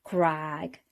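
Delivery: usual speaking voice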